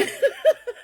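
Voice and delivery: sudden high laugh; real self-mocking laugh; high burst of laughter. Real, not acted. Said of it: "now I cry all the time".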